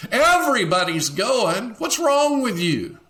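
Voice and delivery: high, loud, needling taunt; mocking pressure. Friend taunting the one who won't go, high and loud. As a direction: push them with scorn.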